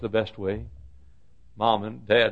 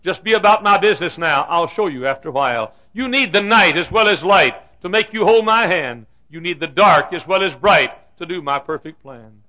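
raised, emphatic proclamation; loud divine voice; 7 semitones higher, 7 dB louder than usual. Acted. God answering his aching child, loud emphatic voice. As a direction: proclaim it with heavenly authority. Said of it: "I heard a loud yet silent voice".

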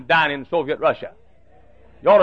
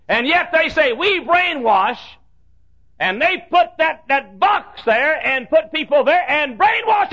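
full-force preaching; 4 semitones higher than usual. Acted. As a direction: hammer each phrase out.

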